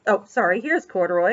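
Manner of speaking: usual speaking voice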